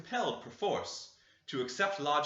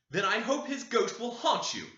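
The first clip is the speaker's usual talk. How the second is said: high, raised and cutting curse; sharp, much higher voice; sharp answer; 9 semitones higher, 4 dB louder than usual. A narrator's reading. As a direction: spit the curse at them. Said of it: "she answered sharply".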